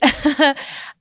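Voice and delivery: a short high laugh; a real giggle at herself; light laugh. Real, not acted. Joking about the prompter, a high real giggle. Laughs after water tip.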